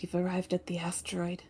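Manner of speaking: soft unvoiced whisper. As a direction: whisper so as not to startle. Quietly waking a sleeping crewmate.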